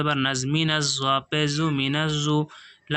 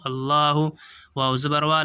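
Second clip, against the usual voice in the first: ordinary delivery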